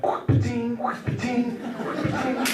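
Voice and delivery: high repeated quack-and-spit noise; tobacco spitting noise. Retold or imitated. Comedian imitates spitting chewing tobacco, high quacking spit noise. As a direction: mimic spitting tobacco, in rhythm. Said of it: "that's the noise it makes".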